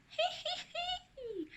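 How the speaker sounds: acted child's hee-hee; high drawn-out giggle. A narrator's reading. Anna heard laughing by friends, high giggle. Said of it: "hear Anna laughing".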